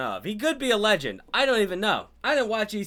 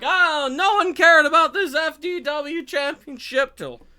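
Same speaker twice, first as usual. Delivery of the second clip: smug boast; 8 semitones higher, 4 dB louder than usual. Retold or imitated. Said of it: "he's like".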